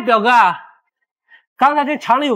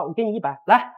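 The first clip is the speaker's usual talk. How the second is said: normal talking voice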